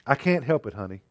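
ordinary talk

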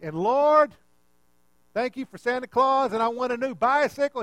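high, loud mock-screamed bedtime prayer; imitating a boy yelling his prayers. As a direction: bellow your Christmas wish to be overheard. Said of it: "He's screaming at the top".

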